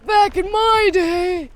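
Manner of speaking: put-on high, loud, slow old geezer voice; hammed-up cranky old man shouting. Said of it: "definitely faking the old man voice".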